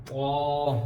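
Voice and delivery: طا with a heavy t and long rounded vowel; full-mouthed, stretched طا; thick, heavy طا. Shown as a demonstration. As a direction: fill the mouth and hold طا. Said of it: "اس کو موٹا کر کے پڑھیں گے".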